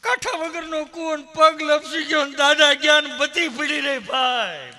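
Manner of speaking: high-pitched comic mourning cry; mock lament. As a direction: wail it out loud and high, hammed-up. Grieving relative wails over grandfather's fall.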